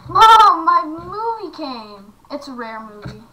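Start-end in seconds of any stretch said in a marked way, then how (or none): 0.1-2.1 excited call. Retold or imitated. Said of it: "I said".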